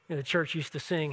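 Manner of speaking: ordinary talk, nothing marked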